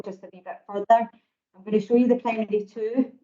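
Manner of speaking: normal talking voice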